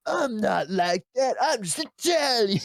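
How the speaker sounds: hammed-up sleazy politician; mocking Cuomo. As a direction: parody the governor's excuse, stretched out. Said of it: "and then being like".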